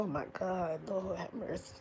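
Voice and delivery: a broken, hushed plea to the Lord; softly murmured grief; hushed prayer. Real, not acted. Grieving, praying to herself.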